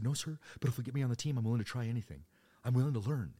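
loud, high, cheeky comeback; smart-ass teen. Retold or imitated. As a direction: play the eager recruit, mock-earnest and loud. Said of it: "me being a smart ass".

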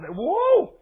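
loud, high whoop of triumph; loud whoa. Acted. Preacher cheering the giant's fall, loud whoop. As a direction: shout it out as he falls.

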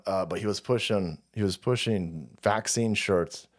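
low, dull mocking voice, slowed down; mock dopey crowd. Mocking the crowd, dim low voice. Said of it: "everybody's sitting there going".

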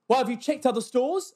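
high, loud challenging question; demanding customer. Retold or imitated. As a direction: imitate a customer pushing back. Acts out rude customer's challenge.

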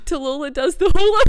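barely gets the words out through laughter; giggling. Real, not acted. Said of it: "I've got the giggles".

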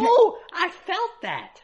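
high, slow yelp of surprise; pained surprise. Real, not acted. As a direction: caught off guard by the burn. First bite of hot food.

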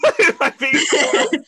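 mock question through laughter; laughing. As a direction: laugh while asking. Mocking friend, laughing.

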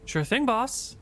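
chirpy eager-to-please sailor; high, bright yes-boss reply; Euclid's voice. Acted. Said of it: "he says".

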